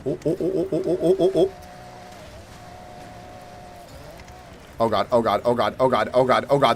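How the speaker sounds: panicked oh oh oh; high frantic yelps; terrified gibbering. Real, not acted. Car sliding in snow.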